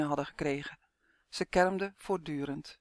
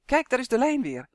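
higher and much louder, thrilled; excited cry; 6 semitones higher, 5 dB louder than usual. A narrator's reading. Gnome excitedly calling the others to the found line. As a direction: shout out the discovery with joy. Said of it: "Torg sprong enthousiast overeind".

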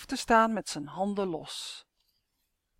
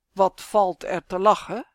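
stern question, pushed louder; 8 dB louder than usual. A narrator's reading. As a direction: demand it strictly, without raising the pitch. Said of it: "vraagt meneer Jas streng". (streng en luid vragend)

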